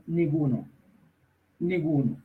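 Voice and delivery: preacher softly echoing "ninguno"; soft repeat. Acted. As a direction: let "ninguno" fall away quietly. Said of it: "repeated ninguno, emphatic then soft".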